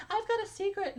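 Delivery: high-pitched schoolgirl with a secret; fifth-grade girl. Acted. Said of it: "like I was a girl in fifth grade".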